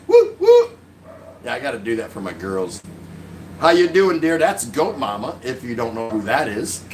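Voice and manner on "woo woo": high, loud whoop of welcome; cheering. Real, not acted. Cheering a viewer's arrival.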